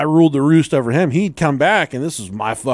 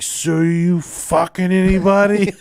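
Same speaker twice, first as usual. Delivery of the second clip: put-on slow, higher-pitched drunken prying; drunk brother; 5 semitones higher, slower than usual. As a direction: imitate a drunk brother drawing out a nosy question. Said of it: "he was drunk and fall".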